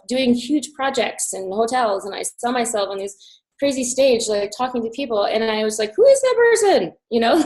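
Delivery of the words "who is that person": high, loud astonished question; high amazed wonder. Retold or imitated. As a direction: gasp at who you see. Amazed at vision of future self.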